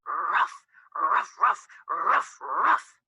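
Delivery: loud mock dog barks; barking dog. Dog refuses the chick, loud barking. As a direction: bark back at the chick. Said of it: "Dog disagreed, barking".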